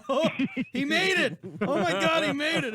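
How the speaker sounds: loud, high burst of disbelief; excited outburst. Retold or imitated. Rider watching from the line, shouting in amazement. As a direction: shout it like you can't believe he pulled it off. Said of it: "I was like".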